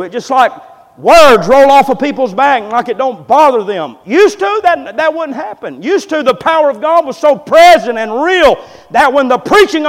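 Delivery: loud, high, driving sermon delivery; fiery preaching. Acted. Preacher stirring the congregation about lost conviction, full-force preaching.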